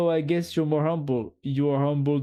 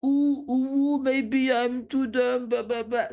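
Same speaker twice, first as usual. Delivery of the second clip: mocking whiny imitation; 9 semitones higher than usual. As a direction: parody the self-doubting friend. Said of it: "you're like".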